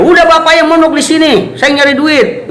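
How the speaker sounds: high, fast, loud mock scolding; emotional outburst. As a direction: fire it off as an angry child telling off a parent.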